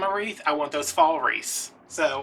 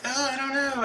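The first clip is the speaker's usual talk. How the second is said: mock clerk voice; 11 semitones higher than usual. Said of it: "And she's like".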